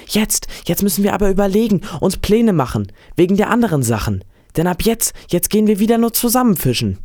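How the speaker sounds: put-on high, insistent boy's voice; young and excited, lightly pressing; eager boy. A narrator's reading. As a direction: urge the old man on, pitched well up. Boy urges plans to fish together.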